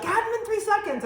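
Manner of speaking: a squeaky, clueless question; high mock question. Wife mimicking her own naive question, high-pitched.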